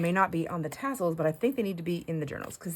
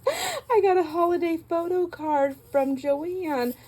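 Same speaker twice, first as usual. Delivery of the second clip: laughing; 10 semitones higher, slower than usual. Real, not acted. Laughing while sharing a card.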